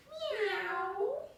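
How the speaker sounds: a high, rising playful meow; cat meow. Pretend. Teacher meowing for the cat pose, high and rising.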